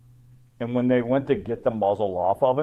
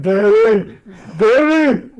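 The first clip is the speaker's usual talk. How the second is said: high, loud calls of Daddy; dog crying for daddy; 7 semitones higher, 6 dB louder, much slower than usual. Retold or imitated. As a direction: call out like an eager dog spotting its owner. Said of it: "he's like".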